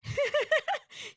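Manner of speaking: high burst of laughter; real high laugh; loud laugh. Real, not acted. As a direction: crack up out loud. Laughing at the referee's moment.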